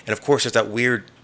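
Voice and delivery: ordinary talk, nothing marked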